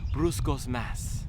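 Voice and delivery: Brooks Ghost Max slowed right down, consonants melted away as pruss coss max; mushy, consonant-free name. Shown as a demonstration. Reviewer savoring the shoe name, mushy and slow. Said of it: "if you take away all the consonants".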